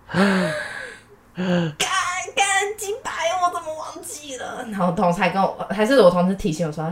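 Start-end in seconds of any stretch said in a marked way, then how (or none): 0.1-4.6 panic. Retold or imitated. Boss asks about the missing file.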